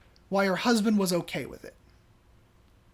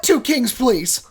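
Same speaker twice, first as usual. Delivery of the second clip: louder, biting repeat of two kings please; pointed mocking echo; 3 semitones higher, 8 dB louder, slower than usual. Wife sarcastically mimics her husband's order, loud. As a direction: throw his words back at him. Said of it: "and she goes".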